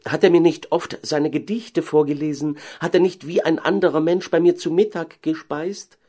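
high-pitched mayor's wife exclaiming; incredulous cry. Acted. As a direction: call out in disbelief, voice lifted. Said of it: "rief die Frau Bürgermeister". (ungläubig ausrufen)

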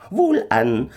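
high, loud little stranger, slow and commanding; kleiner Fremder. A narrator's reading. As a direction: demand it as an uncanny little man. (kleiner Fremder, hoch, laut und befehlend)

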